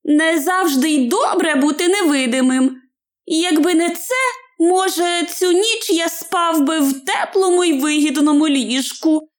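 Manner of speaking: high little hobbit voice; Bilbo, rueful musing. Acted. Hobbit regrets being invisible all night, high rueful musing. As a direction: grumble to yourself, high-pitched.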